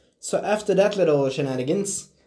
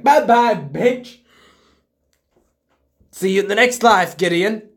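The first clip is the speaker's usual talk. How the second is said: higher, louder gloating goodbye; mocking farewell; 6 semitones higher, 5 dB louder than usual. Mandalorian mocks Gideon as he drops the detonators, gloating farewell. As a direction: gloat as you wave him off. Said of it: "the Mandalorian's like".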